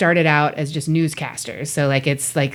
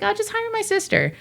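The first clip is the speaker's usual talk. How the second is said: much higher, breezy mock voice; 3 dB quieter than usual. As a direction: lampoon someone hiring family carelessly. Mocking a careless producer.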